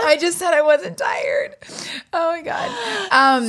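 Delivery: high, breaking laugh-cry. Real, not acted. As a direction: crack up mid-sentence. Laughing at herself, tired.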